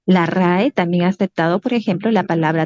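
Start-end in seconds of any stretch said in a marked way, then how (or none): none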